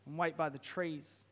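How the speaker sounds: ordinary talk, nothing marked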